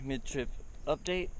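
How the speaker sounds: ordinary delivery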